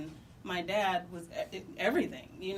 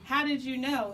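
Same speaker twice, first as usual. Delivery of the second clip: emotional disbelief; 5 semitones higher, 6 dB louder than usual. Retold or imitated. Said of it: "tears rolling down my face".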